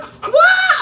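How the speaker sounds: high, loud burst of disbelief; retold shocked yell. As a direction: shout it, stunned. Shocked reaction to the rant.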